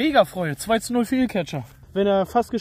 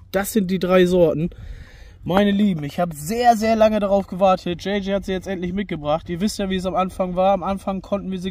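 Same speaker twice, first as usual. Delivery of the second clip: ad read; 6 dB louder than usual. Acted. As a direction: sell the drinks like an ad. Promo pitch for sponsor drinks.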